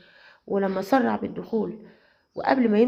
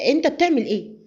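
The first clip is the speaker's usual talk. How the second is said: sharp, higher and louder; irritated; 5 semitones higher, 4 dB louder than usual. Acted. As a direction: snap at him for pressing too close. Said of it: "فسألته بعصبية".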